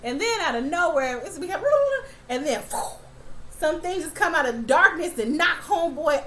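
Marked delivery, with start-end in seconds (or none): 1.7-2.2 sudden squealing laugh; high burst of laughter. Real, not acted. Viewer laughing as monsters attack, high squeal. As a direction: crack up at the gore.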